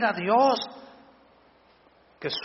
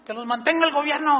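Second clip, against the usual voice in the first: mocking politician; 10 semitones higher, 3 dB louder than usual.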